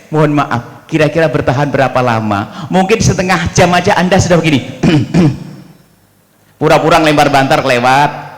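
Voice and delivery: ordinary delivery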